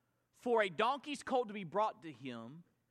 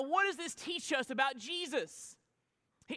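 ordinary talk

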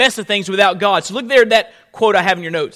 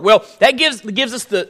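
usual speaking voice

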